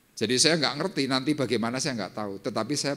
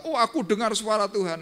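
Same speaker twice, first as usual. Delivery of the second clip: mocking pious friend; 12 semitones higher, 5 dB louder than usual. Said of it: "teman-teman saya yang rohani cerita".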